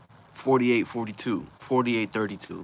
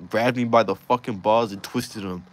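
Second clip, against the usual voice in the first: normal talking voice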